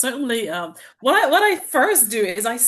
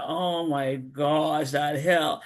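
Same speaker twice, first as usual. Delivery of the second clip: low, very slow mock dread; dreading groan; 7 semitones lower, 3 dB quieter, much slower than usual. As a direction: drag it out like you dread the climb. Dreading the hill ahead.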